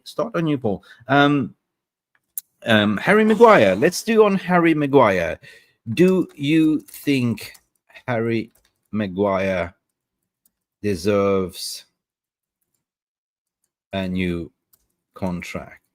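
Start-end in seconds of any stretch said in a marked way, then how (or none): 5.9-15.8 slow dictation. Real, not acted. Typing the poll question aloud.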